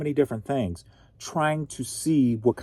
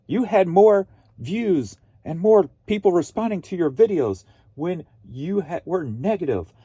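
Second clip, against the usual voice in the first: higher, punchy parody of a miserable commenter; mock commenter translation; 5 semitones higher, 3 dB louder than usual. Translating a viewer's comment for the audience, mock-miserable commenter. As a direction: mockingly voice someone who loves misery, pitched up. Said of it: "let me translate what this person's saying".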